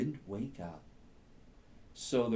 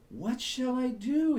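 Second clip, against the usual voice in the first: much higher, a small voiced stage whisper; little rabbit whisper; 13 semitones higher, 5 dB louder than usual. Sleepy rabbit asking the squirrel for help, lightly whispered. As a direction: squeak it like a tiny rabbit. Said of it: "he whispered".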